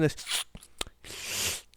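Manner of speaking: talking with retainer out; slow, slurred without the retainer. Shown as a demonstration. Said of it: "I took my teeth out".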